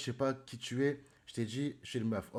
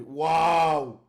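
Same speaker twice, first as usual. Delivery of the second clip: loud, long-held exclamation; shocked wow; 3 semitones higher, 11 dB louder than usual. Real, not acted. Streamer reading chat aloud, suddenly shocked. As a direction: cut off your reading with a big wow.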